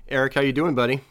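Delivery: usual speaking voice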